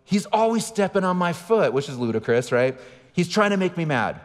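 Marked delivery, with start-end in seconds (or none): 0.0-1.7 high-pitched whining protest; retold high, aggrieved kid voice; mock kid yell. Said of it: "And she yells". 3.1-4.2 high-pitched complaint; retold indignant kid voice; mock kid yell. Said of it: "And she yells".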